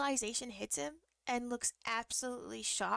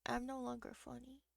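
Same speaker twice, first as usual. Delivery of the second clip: much softer, deflated; small sad voice; 7 dB quieter than usual. Retold or imitated. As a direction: let the joke die in your voice. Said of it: "And I started crying".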